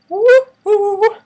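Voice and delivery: very high, loud whooping woo; mock woo cries. Reacting to the curling iron kill, high loud woo. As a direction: hoot it like a shocked scandalized cheer. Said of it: "yes, I said, woo, woo".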